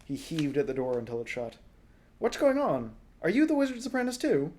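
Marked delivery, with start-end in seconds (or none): 2.2-4.5 high, fast and confused; bewildered boy. Acted. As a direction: blurt out the questions.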